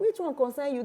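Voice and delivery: high Pidgin echo; Nigerian Pidgin. Retold or imitated. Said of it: "some people like".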